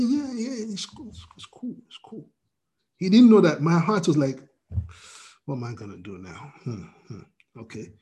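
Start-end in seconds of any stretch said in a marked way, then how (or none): 0.0-2.3 soft reassurance. Retold or imitated. Reassuring the man, hiding worry. 5.5-7.9 much softer, low, fast inner fretting; worried inner voice. Retold or imitated. Preacher voicing his own worried thoughts, quietly anxious. Inner worry about money.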